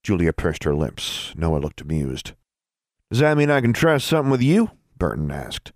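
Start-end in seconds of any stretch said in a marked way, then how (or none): 3.1-4.7 put-on high, teasing boyish voice; cheeky young man voice.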